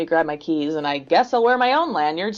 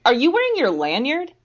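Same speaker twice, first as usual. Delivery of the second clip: higher, louder exasperated demand; exasperated scold; 10 semitones higher, 6 dB louder than usual. Acted. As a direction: scold her for barging in. Colleague barges in annoyed.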